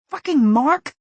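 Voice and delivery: high, loud curse; furious curse. A narrator's reading. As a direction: spit it out. Angry inner curse.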